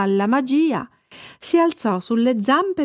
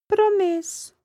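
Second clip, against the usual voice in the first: put-on a lightly raised boy's voice, defensive; boy Thomas; 11 semitones higher, slower than usual. A narrator's reading. Thomas defending himself to the fish. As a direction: protest like a child.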